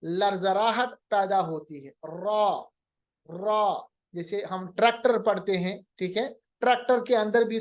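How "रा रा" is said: रा with a light tongue trill. Shown as a demonstration. Teacher demonstrating a slight tongue tremble on रा. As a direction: roll the r in रा a little. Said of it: "रा अदा करते वक्त थोड़ी सी जबान में कपकपाहट".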